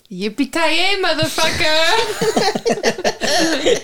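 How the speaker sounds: high, gleeful battle cry; drawn-out yell. Acted. Player taunts the villain over the radio, drawn-out yell. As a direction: yell it high, stretching the last word long. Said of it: "And then I yell".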